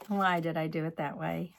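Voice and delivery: ordinary delivery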